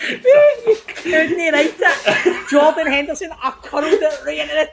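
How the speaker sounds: high laugh, then words stretched through laughter; real laughter slowing the line. Real, not acted. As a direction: crack up at the irony. Laughing at his own story.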